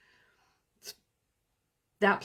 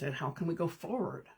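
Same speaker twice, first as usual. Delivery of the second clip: ordinary talk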